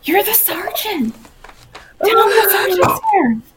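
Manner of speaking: panicked urging. Acted. Player urges companion, panicked.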